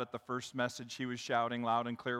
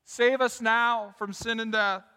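raised, loud cry to God; shouted plea; 6 semitones higher, 10 dB louder, slower than usual. Acted. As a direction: call out as a worshipper pleading for rescue. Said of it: "to shout".

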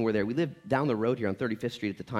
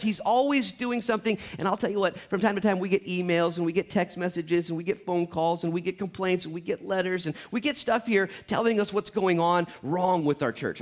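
ordinary delivery

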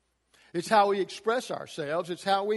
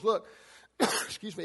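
ordinary talk

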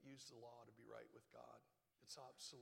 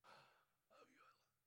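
a gasp, then unvoiced whisper; breathless whisper; 11 dB quieter than usual. Preacher whispering heaven's love, breathless. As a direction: whisper it in awe.

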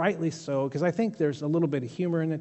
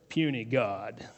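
a hint of Hulk, lower and softer; Hulk's sneer; 5 semitones lower, 4 dB quieter, slower than usual. Hulk sneering at the beaten Loki, low dismissive line. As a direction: drop it dismissively, lightly voiced. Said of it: "And then he says".

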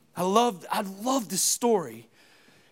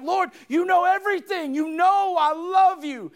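exasperated plea; 8 semitones higher, 4 dB louder than usual. Acted. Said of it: "And he gets mad".

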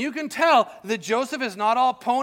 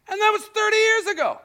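mock outrage; 13 semitones higher, 5 dB louder than usual. Mock outrage about old grudge.

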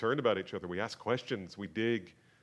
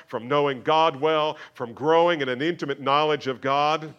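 loud, high, slowed preaching build; fiery pulpit delivery, loud and high; full-force preaching; 6 semitones higher, 10 dB louder than usual. Acted.

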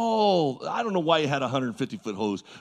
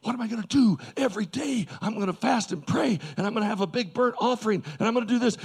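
acted-out fretting, pitched higher; anxious, restless; 7 semitones higher than usual. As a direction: fret aloud like a worried father. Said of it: "does it sound like he's at ease".

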